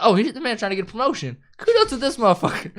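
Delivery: high-pitched, quick, impressed outburst; excited admiration. Retold or imitated. Viewer cheering a man chasing promotion, excited high praise. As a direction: cheer him on, pitch climbing as you go. Retells own admiring first reaction.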